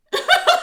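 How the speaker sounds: loud high laugh bursting out; a sudden loud cackle; burst of high laughter. Real, not acted. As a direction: crack up at your own joke. Laughing at her own bit.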